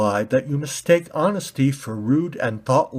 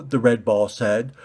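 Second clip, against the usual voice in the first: ordinary delivery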